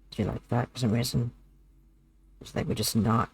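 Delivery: low, soft, minimal-effort voice. Shown as a demonstration. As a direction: talk low and quietly, putting no effort into it. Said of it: "did you enjoy me just not putting effort?".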